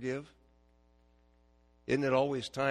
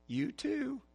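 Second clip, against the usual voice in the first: put-on high old lady's quick reply; grandma voice; 14 semitones higher than usual. Grandma answering on the phone, high pitched. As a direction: chirp it like a grandmother. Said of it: "And she'll say".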